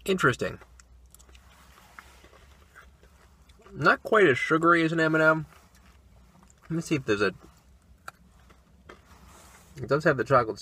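talking with food in mouth; words slightly muffled by chewing; chewing candy. Real, not acted. Seen 0: hand brings candy to mouth, bites it, talks while eating.

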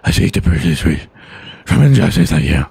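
deep, grave superhero voice; low hero growl. Pretend. Batman Cow declares war on injustice, in a deep growl. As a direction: growl it out like a caped vigilante.

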